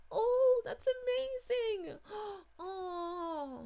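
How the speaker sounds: high, loud and slow, almost tearful with delight; overjoyed. Real, not acted. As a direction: gush with happiness, voice close to tears. A fan loves Joy.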